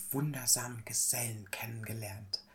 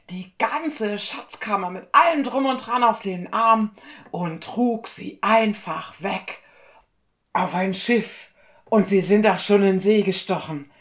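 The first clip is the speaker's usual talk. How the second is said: high, loud, breathless-with-news messenger; panicked servant raising the alarm, high and loud; frantic alarm; 8 semitones higher, 11 dB louder than usual. Acted. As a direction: cry out terrible news to the king. Servant reports the robbery to the king.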